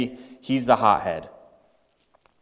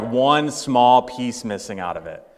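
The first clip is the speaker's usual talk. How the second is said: ordinary talk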